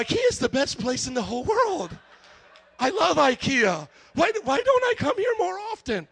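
high, loud, over-the-top happy customer; hammed-up loud adoration; gushing delight. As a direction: gush like a won-over child. Won-over customer gushing about the store.

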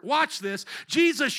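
usual speaking voice

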